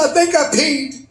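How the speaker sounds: very high, sheepish squeak; starstruck woman. Retold or imitated. Embarrassed fan after falling, very high and sheepish. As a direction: confess it in a tiny high fan voice. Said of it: "She said".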